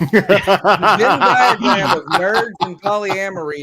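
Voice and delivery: slow, laughing through the words; barely holding back laughter. Real, not acted. Cracking up at his own joke, slow and laughing.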